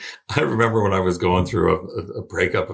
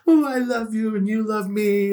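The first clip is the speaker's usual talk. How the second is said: high, sugary mock-romantic voice; mock sappy; 12 semitones higher than usual. Friend mocking sappy love talk, sugary high voice. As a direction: parody of gushing lovers, pitched way up.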